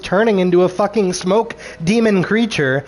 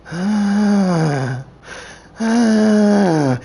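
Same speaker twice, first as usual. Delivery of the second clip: long, held, higher "ahh"; imitated droning "ahh"; 3 semitones higher than usual. Reviewer imitating witches vanishing into smoke, droning. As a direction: drone a sustained note like chanting witches. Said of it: "And you hear this echoey voice".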